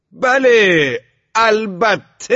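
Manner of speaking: «بله، البته» shouted high, drawn long; very loud yell. A narrator's reading. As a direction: yell «البته» at the top of your lungs, stretching it. («بله، البته» با فریاد بسیار بلند و کشیده)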